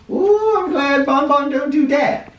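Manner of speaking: high squeaky put-on voice with a playful ooh; squeaky mock voice gasping at the mess; silly high voice. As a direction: pipe it up like a cartoon character. Reader's aside in silly voice.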